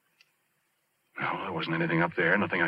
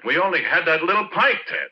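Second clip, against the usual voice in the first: raised, higher, louder protest; insisting he only had a drink; defensive protest; 12 semitones higher, 5 dB louder than usual. Acted. Porky protesting to the police, loud and defensive. Porky questioned about a death.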